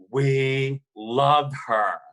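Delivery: slow, drawn-out reverent declaration; father leaning back, slowly saying we love her. Retold or imitated. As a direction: savour each word like a confession of love. Father reveres [name].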